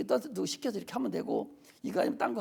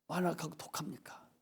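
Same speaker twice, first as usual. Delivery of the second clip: a preacher's sudden whispered reproach; breathy hushed rebuke; 9 semitones lower, 9 dB quieter than usual. Real, not acted.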